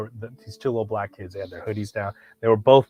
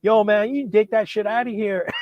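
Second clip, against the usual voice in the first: security guard; 10 semitones higher, 3 dB louder than usual. Retold or imitated. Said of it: "and he's like".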